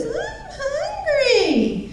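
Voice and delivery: put-on high, very slow, drawn-out toddler whine; whiny voice. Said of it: "using a whiny voice".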